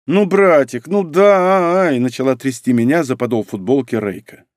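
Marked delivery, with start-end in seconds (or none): 0.1-2.0 high-pitched pleading little sister; wheedling. A narrator's reading. Little sister begging her brother for money, wheedling. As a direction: whine and beg, drawing it out.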